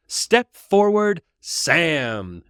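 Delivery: Merry theatrically calling Sam forward. Acted. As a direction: announce him like a grand reveal. Merry summons hidden Sam.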